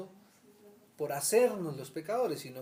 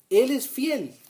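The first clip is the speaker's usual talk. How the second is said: "Él" hit hard, loud and high; stress on Él; 9 semitones higher, 13 dB louder, much slower than usual. Real, not acted. Preacher proclaiming to the study group, loud stress on "Él". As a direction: ring out "Él es fiel". Emphatic verse reading.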